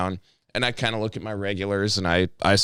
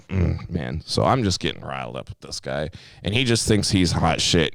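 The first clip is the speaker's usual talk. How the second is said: usual speaking voice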